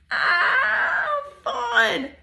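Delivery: very high, drawn-out delight; squealed storytime delight; delighted exclamation. Pretend. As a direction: coo with glee for the children. Delighted reaction for children.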